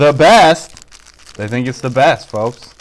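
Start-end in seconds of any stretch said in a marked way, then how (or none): 0.0-1.4 loud, drawn-out echo of disbelief; hyped amazement. Acted. Dad echoing the kids' food verdict, hyped amazement. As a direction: play up the astonishment.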